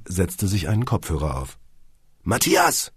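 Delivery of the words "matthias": ordinary delivery, nothing marked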